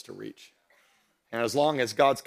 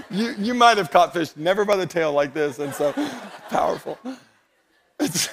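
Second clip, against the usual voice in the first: high, loud words bubbling with laughter; talking through laughter; 6 semitones higher, 4 dB louder than usual. Real, not acted. Preacher joking about a fish caught by the tail, laughing. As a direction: try to carry on while cracking up. Cracking up at his fishing demo.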